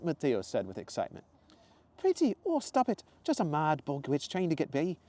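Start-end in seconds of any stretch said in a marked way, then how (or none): 2.0-5.0 flattered Zoe. Acted. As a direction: brush off the compliment up high.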